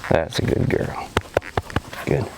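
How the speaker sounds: hushed whisper of praise, close to the dog. Real, not acted. Trainer whispering praise to her dog at heel. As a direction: breathe the praise softly.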